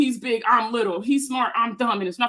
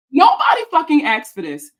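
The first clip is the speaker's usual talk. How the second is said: exasperated; 4 semitones higher, 7 dB louder than usual. Real, not acted. Outraged rant.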